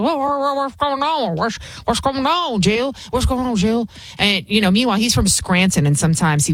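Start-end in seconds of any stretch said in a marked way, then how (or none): 0.0-3.9 lightly voiced, higher, dazed old man just woken; mock a confused old man jolted awake, pitched up; groggy Joe Biden. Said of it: "He was like".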